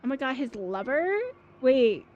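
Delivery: ordinary talk, nothing marked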